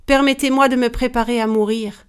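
ordinary delivery

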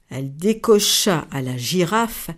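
usual speaking voice

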